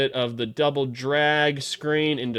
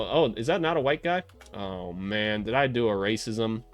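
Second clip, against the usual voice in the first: usual speaking voice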